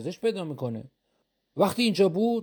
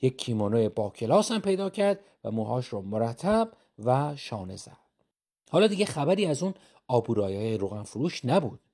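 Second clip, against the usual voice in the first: plain reading voice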